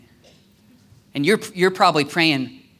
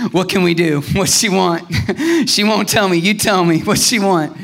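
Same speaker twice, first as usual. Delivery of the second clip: raised, laughing, eager questions; giddy acted eagerness; 3 semitones higher, 3 dB louder than usual. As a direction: play a prophet bursting to help, chuckling between questions. Acts Elisha giddy, asking the servant.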